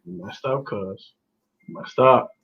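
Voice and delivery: low, softened grumble; dejected muttering. Retold or imitated. Fan answering his cousin after the pick, dejected muttering. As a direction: mutter it down low, as if still stewing. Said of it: "Why you say it like that".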